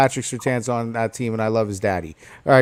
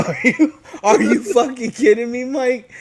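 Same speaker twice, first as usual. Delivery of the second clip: laughing disbelief; 8 semitones higher than usual. Real, not acted.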